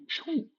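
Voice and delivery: high quick whoosh sound; whoosh of escape. Retold or imitated. Teller running off, high whoosh sound. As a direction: mimic a fast getaway.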